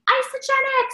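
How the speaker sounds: high, stretched-out sing-out; mock scream. Networker plugging the company on Facebook, mock screaming. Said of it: "without screaming".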